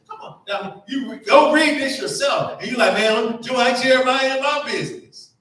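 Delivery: rising, loud preacher call; climbing sermon call to the pews; preaching fervor. Acted. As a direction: build it up and call it out. Preacher calls out to congregation.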